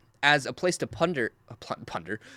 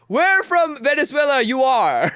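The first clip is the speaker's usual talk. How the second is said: broken English; 6 semitones higher than usual. Retold or imitated. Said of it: "It's broken English".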